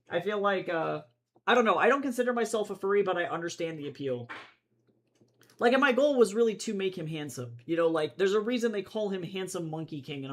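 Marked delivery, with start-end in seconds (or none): none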